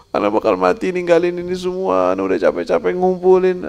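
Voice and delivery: high, whining lament over his riches; lamenting rich man. Retold or imitated. Rich man lamenting leaving his wealth, high whining voice. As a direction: complain he must leave it all behind.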